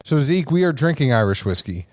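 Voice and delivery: ordinary talk, nothing marked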